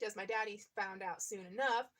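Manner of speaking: usual speaking voice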